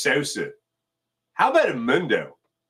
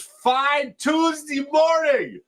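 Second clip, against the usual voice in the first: much higher, slow, stretched-out hype call; drawn-out greeting; 12 semitones higher, much slower than usual. Real, not acted. Host greeting his chat, slow, soaring excited call. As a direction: stretch the words like a morning show host.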